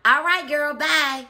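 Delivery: high, loud quick goodbye while walking off; breezy brush-off. Retold or imitated. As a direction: toss off a cheerful bye. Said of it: "we're really like".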